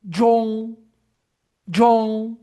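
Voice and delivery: John said as zho, high and loud; model syllable. Shown as a demonstration. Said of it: "E o último aqui". (John pronunciado como zho)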